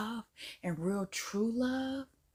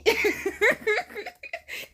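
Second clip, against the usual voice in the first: high burst of laughter; loud laugh; 11 semitones higher, 6 dB louder than usual. Real, not acted. Reacting to the clip, bursting into laughter. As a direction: crack up at your own joke.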